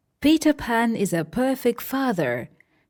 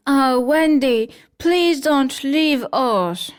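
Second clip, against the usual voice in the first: higher, slow mild plea; pleading sadly; 5 semitones higher, 5 dB louder than usual. Acted. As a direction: beg her to stay. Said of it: "The lost boys are sad".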